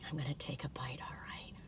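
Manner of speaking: whispered, very soft; hushed anticipation. Real, not acted. Reviewer about to taste the cookie, whispering. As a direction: whisper it before the big bite. Hushed moment before the first bite.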